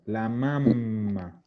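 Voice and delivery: mamma with a long, hummed double M. Shown as a demonstration. Teacher showing students how to pronounce it, lengthened M in mamma. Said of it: "Siempre con doble M, pronunciando".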